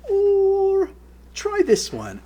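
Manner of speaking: very high, imitated English accent; Sharon Osbourne. Host requesting another song, high English accent. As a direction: draw out the first word, high and English. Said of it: "in her English accent".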